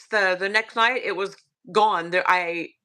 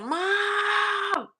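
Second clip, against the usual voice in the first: put-on a high, piercing child's scream for mom; frightened kid shrieking "Mom!" from bed; 12 semitones higher than usual. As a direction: yell for your mother in terror at night. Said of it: "I screamed for my mom".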